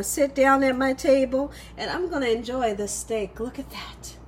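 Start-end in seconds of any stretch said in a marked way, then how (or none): 3.4-4.2 breathy whisper. Real, not acted. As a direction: hush it to a whisper. Hushed look at the food.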